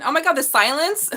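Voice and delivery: high, loud burst of surprise; excited exclaim. Real, not acted. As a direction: blurt it out, thrilled. Sudden exclaim over card.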